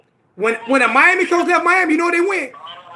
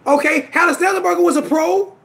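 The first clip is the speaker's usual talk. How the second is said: ordinary talk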